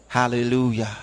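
normal talking voice

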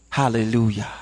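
usual speaking voice

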